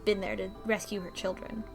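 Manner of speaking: ordinary talk, nothing marked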